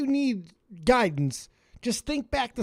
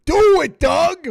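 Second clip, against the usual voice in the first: shouting; 7 semitones higher, 11 dB louder, slower than usual. Real, not acted. Yelling at caller.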